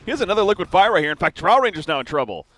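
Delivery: loud, high-pitched fight commentary; excited play-by-play. Real, not acted. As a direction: call the fight with rising urgency and volume.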